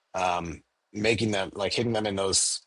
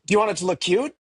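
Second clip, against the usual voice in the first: higher, louder, eager shopkeeper voice; imitating a clerk thrilled to make a gift cute; Tara excited; 11 semitones higher, 6 dB louder, faster than usual. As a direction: chirp it like a delighted gift wrapper. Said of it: "And she's like".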